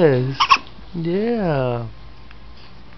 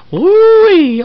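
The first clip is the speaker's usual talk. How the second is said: high, loud woo-wee; excited squeal; 14 semitones higher, 9 dB louder than usual. Real, not acted. Excited cooing at baby.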